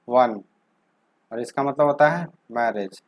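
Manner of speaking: ordinary talk, nothing marked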